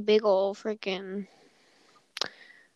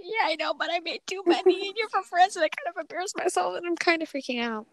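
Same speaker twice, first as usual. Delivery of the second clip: higher, rushed, flustered apology; flustered panic; 8 semitones higher, faster than usual. Retold or imitated. To the French friend, retelling her own flustered, high, rushed apology. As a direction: gush like you're mortified.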